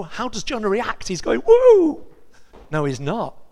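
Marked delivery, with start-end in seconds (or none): 1.5-2.0 much higher, much louder whoop; a hammed-up celebratory whoop; mock cheer. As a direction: pretend joyful woo.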